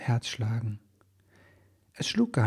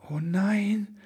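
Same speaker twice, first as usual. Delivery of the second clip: breathy whispered sigh, higher; 10 semitones higher than usual. A narrator's reading. Elf man sighs at the broken presents, whispered. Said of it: "seufzte Ribbelwitsch".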